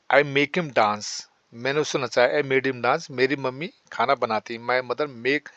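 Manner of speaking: ordinary talk, nothing marked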